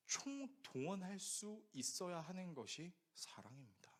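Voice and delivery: slow soft whisper; whispered emphasis. Real, not acted. Preacher stressing what love is, whispered slowly. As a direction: drop to a whisper to land it.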